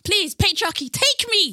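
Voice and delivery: loud, high, dramatic plea; mock pleading. Woman begs marriage to change her surname, mock pleading. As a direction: beg theatrically, voice climbing.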